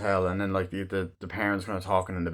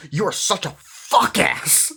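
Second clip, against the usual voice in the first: higher, slow, drawn-out mock insult; silly insult; 9 semitones higher, 3 dB louder, much slower than usual. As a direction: stretch out the dumb insult in a put-on voice. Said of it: "essentially he goes".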